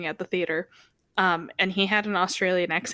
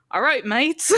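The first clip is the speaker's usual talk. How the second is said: put-on higher, playful Australian accent; mock Aussie accent; 5 semitones higher, 4 dB louder, slower than usual. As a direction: put on a hammed-up Aussie greeting, half laughing. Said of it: "No, the Aussie accent".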